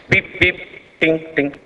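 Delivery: high mock bleeps standing in for swear words; playful beep beep ting ting; censor beeps. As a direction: imitate a censor bleep over a villain's cursing. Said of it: "So he talks like this".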